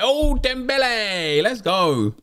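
drawn-out high cheer at a good pull; excited. Real, not acted. Opening a pack and pulling Dembele, excited.

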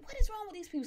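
very high-pitched, incredulous outburst; exasperated disbelief. Retold or imitated. Customer fed up with repeated wrong chicken wings, high exasperated disbelief.

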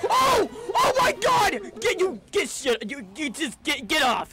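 high, loud panicked yelling; real shouting. Real, not acted. Gamer getting jumped by an enemy, real loud panicked shouting. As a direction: yell it out as you get jumped. Getting killed mid-fight.

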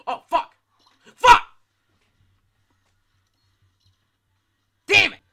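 raging shouts; furious yelling. Real, not acted. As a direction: scream it in frustration. Dying in game.

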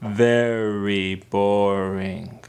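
very slow, deep, drawn-out drone; bored drone. Acted. Listener echoing that khutbahs are boring, in a slow bored drone. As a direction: drag each word out low and heavy.

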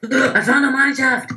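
loud, high excited cry; thrilled shout of discovery; excited call. Real, not acted. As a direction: announce the find with glee. Discovering mine shaft in game.

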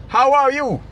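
mock Melaka greeting. Streamer teasing viewers from Melaka, mock greeting. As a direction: tease it the way a Melaka local might say it. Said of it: "Ada orang Melaka dengar kata gitu".

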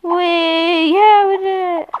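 happy shout of victory; excited cheer. Real, not acted. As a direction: cheer the win, loud and high. Cheering a win in game.

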